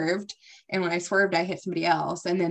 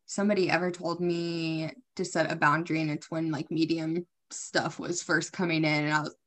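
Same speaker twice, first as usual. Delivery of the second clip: usual speaking voice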